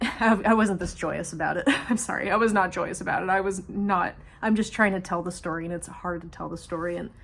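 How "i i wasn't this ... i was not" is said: laughing through the words; nervous laugh as she talks; coping laugh, half apologetic; laughing while telling. Real, not acted. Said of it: "So if I'm smiling and I'm laughing".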